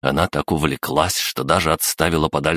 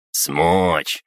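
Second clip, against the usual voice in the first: categorical crab gnome. Acted. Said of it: "категорично возразил крабогном".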